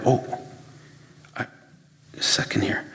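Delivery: half-whispered, trailing off into the phone; absent-minded whisper to yourself; distracted whisper. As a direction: murmur it like your eyes are on a text. Distracted by texts, self-quote.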